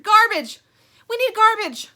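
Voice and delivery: very high, loud eureka cry; Stingray's loud excited eureka; inspired shout. Acted. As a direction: shout the bright idea. Stingray's sudden idea.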